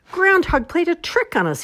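high, loud outcry; indignant yell. A narrator's reading. Squirrel discovering Groundhog's trick, high yell. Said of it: "he yelled".

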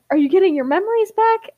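high, quick astonished question; gasping surprise. A narrator's reading. Ember startled by Ash's sudden reply, gasping surprise. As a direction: ask it fast and high, startled. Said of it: "Ember gasps and says".

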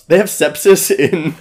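breaking into a laugh mid-question; laughing disbelief. Real, not acted. Friend laughing at a movie scene, incredulous. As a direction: laugh through the words in disbelief. Incredulous about blood test.

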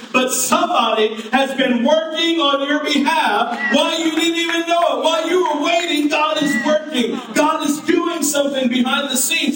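high, ringing preacher's climax, building; full-force preaching. Acted. Pastor rousing the congregation to believe, ringing and high. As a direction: preach it out to the whole church.

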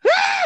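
very high loud mock shriek; scream. Friend imitates her screaming at the maggot apple. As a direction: shriek like you just saw maggots. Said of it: "You said".

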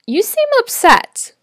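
"seem" lifted high, tentatively noticing; stress on seem. Acted. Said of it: "emphasize the word seem".

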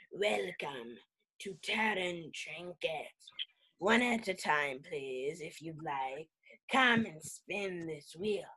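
lightly voiced tiefling shopkeeper. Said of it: "The tiefling says".

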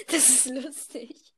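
giggling through the words; laughing. Real, not acted. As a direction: laugh it out, slowly. Laughing at own joke.

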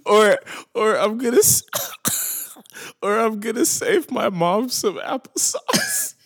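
can barely get it out laughing; laughing through words. Real, not acted. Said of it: "somebody said".

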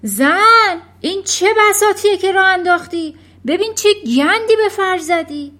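high, loud, quick outraged yell; furious husband's high-pitched shout; angry shouting. A narrator's reading. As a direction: bellow at your wife in outrage. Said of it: "آقای ورمود فریاد زد".

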